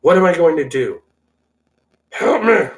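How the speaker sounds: loud, high-pitched hammed-up despair; mock panic. Host unable to contain himself, mock panicked plea. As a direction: build to a melodramatic cry for help. Acted meltdown, cannot contain myself.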